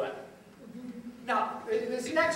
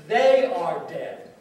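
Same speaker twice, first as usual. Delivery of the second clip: slow, louder quoted shout; measured mock shout, each word weighted; retold shout; 4 semitones lower, 6 dB louder, slower than usual. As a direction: quote a shout slowly and pointedly, without really yelling. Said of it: "why didn't he shout".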